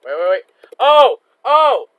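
high, loud alarmed cries; panicked shout. Real, not acted. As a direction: yelp in real panic. Sudden scare in game.

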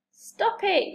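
high-pitched command, little added volume; contained storybook yell; alarmed yell. A narrator's reading. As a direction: call out to halt it. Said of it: "yelled Harold".

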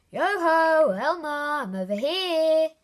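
loud, high playful call. A narrator's reading. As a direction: sing out from far away. Hidden ventriloquist calling out.

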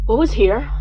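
alarmed. Retold or imitated. Spooked sudden question.

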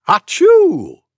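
big theatrical sneeze; loud sneeze. Acted. Opening sneeze demo.

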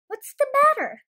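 high, loud startled ask; startled question. Acted. Goosey Lucy greeting the anxious birds, high and startled. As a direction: pop the question in surprise.

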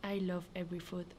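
normal talking voice

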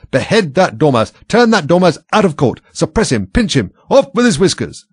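enraged queen shrieking at the court. A narrator's reading. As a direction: scream the orders one after another. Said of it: "the queen shrieked out".

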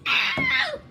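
very high play-acted yelp of pain; short, squealed "ow". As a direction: yelp as if suddenly hurt.

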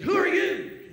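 loud, high challenge from the yard; startled shout. Retold or imitated. A stranger in the yard shouts at the pastor, loud and high.

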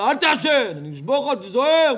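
loud, high angry shout; threatening father. Shown as a demonstration. Smoking father threatening his son, shouting angrily. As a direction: bellow a warning as a hypocritical father. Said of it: "אבל כשאבא מעשן אומר לו".

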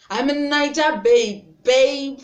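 loud, high, proud Nigerian accent. Shown as a demonstration. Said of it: "this is my real accent".

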